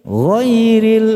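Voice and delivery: "ghairil" chanted high as "ghoiril"; long high "ghairil", sounded ghoy-ril; dark vowel ghairil. Shown as a demonstration. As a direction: sing "ghairil" with a rounded o-like first vowel. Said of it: "Baca goir aja".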